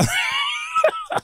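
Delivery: very high, shouted laugh; shrieking laugh. Real, not acted. Ending his own story, a shrieked laugh.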